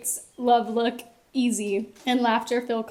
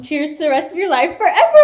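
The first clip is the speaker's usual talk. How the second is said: excited cheer; 6 semitones higher, 10 dB louder, faster than usual. Real, not acted. Toast climax.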